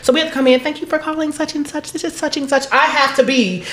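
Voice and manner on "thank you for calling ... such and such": higher, softer customer-service voice. Acted. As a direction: put on a polite representative's voice for callers.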